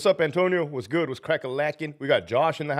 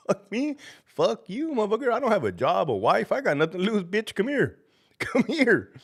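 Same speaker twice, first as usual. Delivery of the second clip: mock challenge; 8 semitones higher than usual. Daring haters to come fight.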